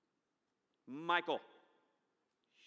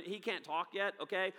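ordinary delivery

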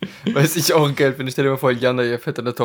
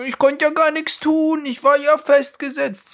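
high whiny put-on excuse; high pleading innocent voice; mock Gorbachev quote. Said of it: "So nach dem Motto".

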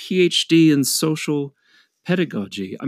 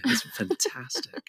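breathy mock whisper; hushed gush; 6 dB quieter than usual. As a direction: whisper it like a secret thrill. Mock excited whisper about show.

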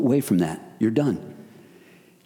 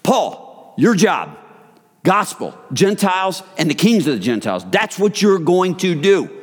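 voice of Jesus commissioning Paul; 7 semitones higher than usual. Retold or imitated. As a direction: hand Paul his orders with force. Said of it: "That's what he told him".

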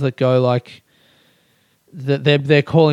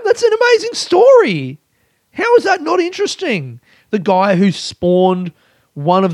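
much higher, incredulous and exaggerated; hammed-up disbelief; mock indignation; 10 semitones higher than usual. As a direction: play it as outraged disbelief. Mock outrage at uninterested friends.

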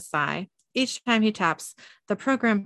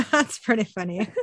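laughing; 2 semitones higher than usual. Real, not acted. Host reacting to co-host's joke, laughing. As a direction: laugh as you say it.

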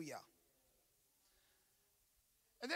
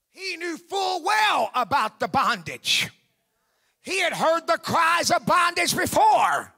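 raised, driving sermon voice; forceful preaching; 6 semitones higher, 12 dB louder than usual. Real, not acted. Preacher telling church how Moses awakened, forceful preaching.

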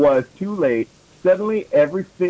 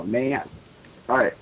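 normal talking voice

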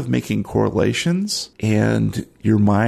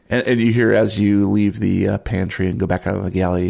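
usual speaking voice

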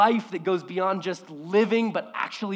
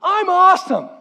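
much higher and louder, exuberant boast; playful boast; 13 semitones higher, 13 dB louder than usual. Retold or imitated. God boasting to Adam and Eve, exuberant and loud. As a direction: crow it with glee. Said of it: "He looks at them and goes".

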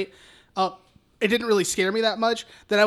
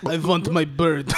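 low, hammed-up Russian accent; mock Russian accent; 5 semitones lower than usual. Podcaster riffing on Alex's vodka voice, mock Russian accent. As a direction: play a vodka-drinking Russian for laughs. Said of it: "That's Russian, Alex".

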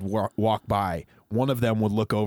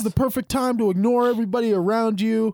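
much higher, louder stage-host voice; 10 semitones higher, 4 dB louder than usual. Retold or imitated. Singer talking to the crowd between songs, high and loud. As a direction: hype up the crowd like a singer onstage. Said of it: "[name], he even goes".

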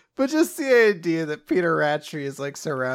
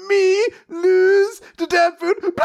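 dumb brute voice; 12 semitones higher, 4 dB louder than usual. Retold or imitated. Friend mocking Ratri running away, dumb brute wail. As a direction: ham it up like a fleeing oaf.

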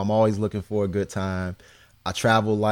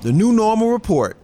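upbeat news report delivery; news segment read; 4 semitones higher than usual. Acted.

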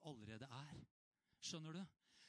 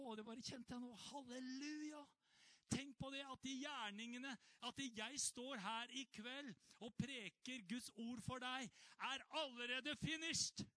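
preaching at full force; 8 semitones higher than usual. Acted. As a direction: press the truth home to the church.

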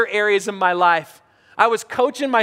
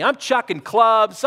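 ordinary delivery

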